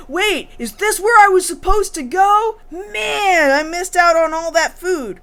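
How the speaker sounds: high, whiny-comic disbelief; mock dismay. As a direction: act out dismay at missing out. Acting Ryo's dismay over food.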